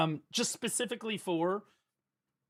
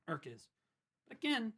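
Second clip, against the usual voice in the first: ordinary delivery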